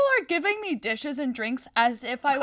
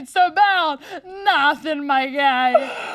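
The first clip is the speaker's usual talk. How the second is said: laugh-crying; 2 semitones higher, 5 dB louder, much slower than usual. Real, not acted.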